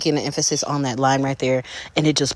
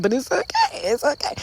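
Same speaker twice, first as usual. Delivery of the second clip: high reassurance; 13 semitones higher than usual. Pretend.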